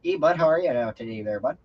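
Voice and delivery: hammed-up Canadian greeting; Canadian accent. Shown as a demonstration. Showing Dad how to say hello, in a Canadian accent. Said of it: "say it in a Canadian accent".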